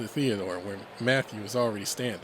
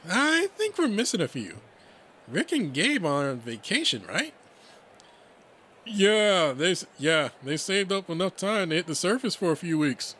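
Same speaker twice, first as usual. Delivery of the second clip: higher, animated character voice; lively, raised-pitch banter; chatty friends' dialogue; 6 semitones higher, 3 dB louder than usual. Acted. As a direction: toss it out brightly over lunch.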